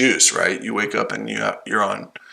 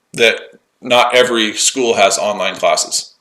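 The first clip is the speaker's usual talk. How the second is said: ordinary talk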